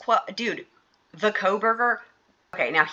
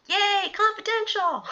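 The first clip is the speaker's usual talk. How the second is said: high, slow, mock-delighted cheer; sarcastic cheer; 12 semitones higher, slower than usual. Mocking the survey's exclamation point to viewers, sarcastic high cheer.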